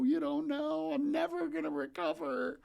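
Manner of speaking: high, wailing hopeless plea; despairing kid. Retold or imitated. A twelve-year-old insisting to his stepmom he is ruined, high and despairing. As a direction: cry out like the world is ending.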